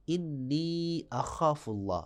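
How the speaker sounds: انی اخاف اللہ said slowly with the long vowels held; slow recitation. Shown as a demonstration. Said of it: "یا مدہ کی". (آہستہ تلاوت)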